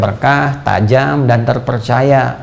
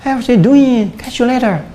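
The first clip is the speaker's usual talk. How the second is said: higher, breezy imitation of a native English speaker; playful English native accent, higher voice; 9 semitones higher, slower than usual. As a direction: toss it off like a casual Brit. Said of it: "Bahasa Inggris kayak orang Inggris".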